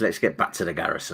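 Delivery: low, soft Brummie impression; Peaky Blinders Brummie accent. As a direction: repeat the show's line in Brummie, dropped down quietly. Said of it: "some of my Peaky Blinders".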